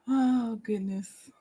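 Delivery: ordinary delivery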